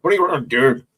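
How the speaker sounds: loud, harsh Northern Irish impression; Northern Irish accent. Shown as a demonstration. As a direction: confrontational mock Northern Irish. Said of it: "like, Northern Irish".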